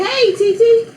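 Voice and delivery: put-on very high little-child greeting; three-year-old niece. Three-year-old niece phones her aunt, imitated child voice. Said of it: "My three-year-old niece".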